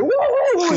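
high-pitched mock monkey hooting; monkey hoots. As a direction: hoot like an ape in a shouting match. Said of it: "y se vuelven monos".

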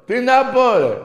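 high, loud fed-up yell; exasperated shout. Real, not acted. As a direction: throw up your hands loudly. Exasperated outburst.

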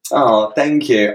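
ordinary talk, nothing marked

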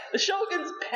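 can't hold the laugh in. Real, not acted. Correcting the misheard word, laughing.

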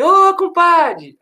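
high, loud and very slow "Ô, compadre!" with the "Ô" stretched; drawn-out greeting call. Acted. Clown doctors greeting the patient at the bedside, loud stretched call. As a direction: call out "Ô, compadre!" cheerfully, dragging it out.

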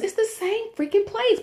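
high, exasperated disbelief. Real, not acted. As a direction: throw up your hands at the absurdity. Griping about Rally's vs Checkers.

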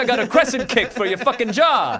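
loud, laughing fan bravado; hammed-up tough-guy yell; mock macho taunt. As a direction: jeer the fighter with fake menace. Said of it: "Come here".

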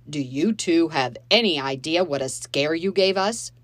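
put-on rising anger at the kids. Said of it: "his voice rising".

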